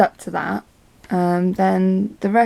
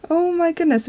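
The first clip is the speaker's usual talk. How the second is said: shocked aside; 9 semitones higher than usual. Retold or imitated. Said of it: "I was like".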